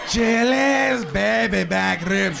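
slow, loud jingle announcer; booming ad tagline. Acted. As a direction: belt out the tagline like an ad.

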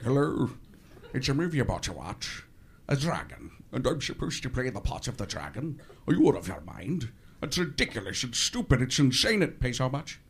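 indignant Connery on the phone; Sean Connery. Retold or imitated. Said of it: "He's like".

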